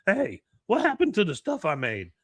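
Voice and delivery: loud, high mock complaint; indignant Jimmy. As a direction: demand it as a worked-up oddball. Jimmy confronts the art dealer.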